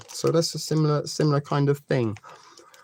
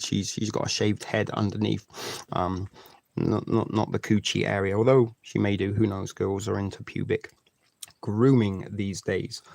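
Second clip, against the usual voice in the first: lower, softer cheeky aside; quiet aside; 5 semitones lower, 5 dB quieter than usual. Real, not acted. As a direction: mutter it under your breath.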